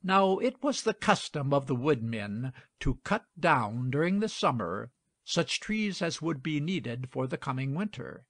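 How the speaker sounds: ordinary delivery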